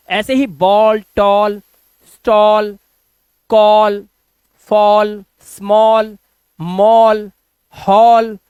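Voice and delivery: each word with a loud rounded "aw": बॉल, टॉल, stall, कॉल; drilled word list. Shown as a demonstration. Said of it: "वो ओ है".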